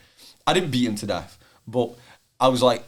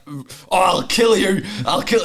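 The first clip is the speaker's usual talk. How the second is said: mock threat; 8 semitones higher, 4 dB louder than usual. Retold mock threat, laughing.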